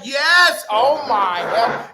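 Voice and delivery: loud, high, drawn-out hype; big whooping excitement; excited cheer. Real, not acted. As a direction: shout it like the countdown just ended. Hyped stream countdown cheering.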